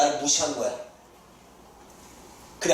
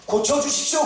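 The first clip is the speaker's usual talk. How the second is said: pleading request; 8 semitones higher than usual. Retold or imitated. Preacher voices the request.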